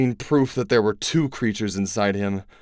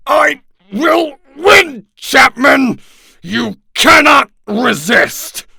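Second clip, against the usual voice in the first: much higher, louder, slow roar; triumphant shout; 14 semitones higher, 9 dB louder, much slower than usual. Acted. Yeerk roars at the Chapman host as it regains control. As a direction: bellow each word as a victory.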